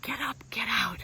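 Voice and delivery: whispered, breathy and soft; a private whisper of the mantra. Shown as a demonstration. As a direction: whisper it to yourself. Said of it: "guess what mine is?".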